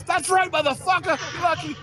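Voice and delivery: high-pitched mock tough talk; cowardly taunting. Husband hiding behind his wife, high taunting yell. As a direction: crow it from safely behind someone, voice way up.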